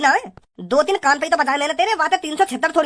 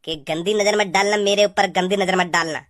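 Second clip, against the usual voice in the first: mocking mimicry; 9 semitones lower than usual. Man throws the girl's warning back at her, low mocking mimicry. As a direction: parrot the warning back, pitched well down.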